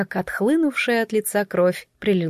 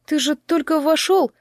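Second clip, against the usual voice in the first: high-pitched startled question; nervously surprised; taken aback; 8 semitones higher than usual. A narrator's reading. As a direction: ask it caught out, voice lifted. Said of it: "сглотнув, спросила девушка".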